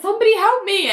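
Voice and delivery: high-pitched acted plea for help; hammed-up distress; mock panic. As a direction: cry out like a frightened patient. Frightened patient calls for help.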